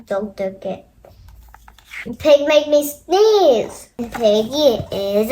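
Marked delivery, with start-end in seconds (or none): 0.1-0.8 low, soft and gloomy; mope, say it quietly; sad, quiet. Acted. Gerald miserable, not okay.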